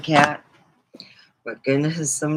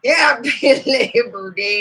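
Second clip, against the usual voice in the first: laughing through it; 6 semitones higher, 6 dB louder, slower than usual. Retold or imitated. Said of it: "he goes".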